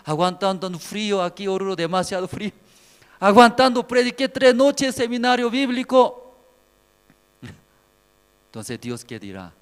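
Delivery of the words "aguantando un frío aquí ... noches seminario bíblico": high-voiced boast of hardship, swelling louder; boastful petitioner to God. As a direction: plead your merits to God. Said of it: "Usted, cuando presenta ante Dios".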